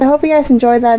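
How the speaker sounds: ordinary delivery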